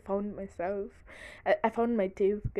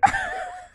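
shrieking burst of laughter; high laugh; 9 dB louder than usual. Real, not acted. Laughing at her own joke to viewers. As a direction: cracks up again.

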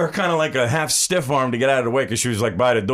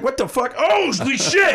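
higher, startled cry of disbelief; shocked outburst; 11 semitones higher than usual. Retold or imitated. Reliving the stabbing, shocked yelp. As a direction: blurt it like you just got stabbed. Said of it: "I was like".

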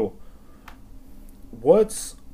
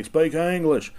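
sudden raised voice, imitating angry bigots; mock angry demand; 6 semitones higher, 6 dB louder, slower than usual. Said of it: "they'll say".

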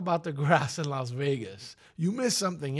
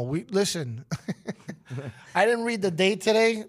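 ordinary delivery

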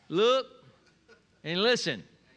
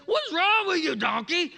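much higher, louder exasperated yell at the donkey; hammed-up fury at a stubborn animal; mock angry yell; 8 semitones higher, 4 dB louder than usual. Balaam yelling at donkey.

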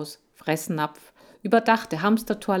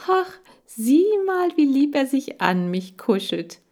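put-on delighted girl; 9 semitones higher, 5 dB louder than usual. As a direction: squeal over the cuddly hamster. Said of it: "meinte Kira begeistert".

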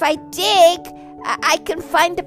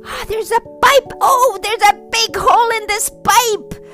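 excited discovery; 6 semitones higher than usual. Acted. Bunny discovers the leak.

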